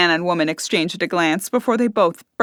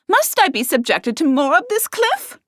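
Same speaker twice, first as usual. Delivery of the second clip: higher, indignant complaint; 10 semitones higher than usual. A narrator's reading. Henrietta exasperated by flirting.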